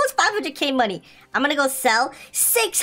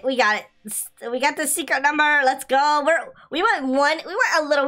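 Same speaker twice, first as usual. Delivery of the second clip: normal talking voice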